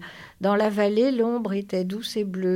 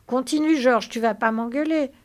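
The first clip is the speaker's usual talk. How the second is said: high, loud, eager plea; passionately eager; 5 semitones higher, 7 dB louder than usual. Acted. Lénie hangs on George's words, passionately eager. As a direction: press him excitedly. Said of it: "Lénie le regardait avec passion".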